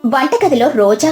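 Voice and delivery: plain reading voice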